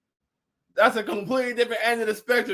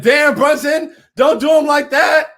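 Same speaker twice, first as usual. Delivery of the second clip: higher, louder teasing yell at Brunson; loud mock outrage; 7 semitones higher, 10 dB louder, slower than usual. Reading a chat comment about Brunson, loud mock outrage. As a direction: holler it like a fan at the game. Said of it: "Big Ty Asking Hand said".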